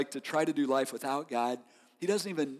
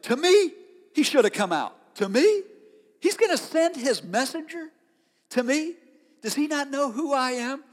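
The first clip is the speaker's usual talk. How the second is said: indignant repeated "To me?", much higher; emphatic, offended "to me"; 9 semitones higher than usual. Retold or imitated. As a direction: stress "to me" like a wounded proud man. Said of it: "Hebrew are emphatic".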